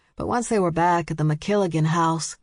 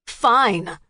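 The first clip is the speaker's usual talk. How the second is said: louder angry yell; 4 semitones higher, 4 dB louder than usual. A narrator's reading. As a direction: snap back, loud. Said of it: "Max yelled".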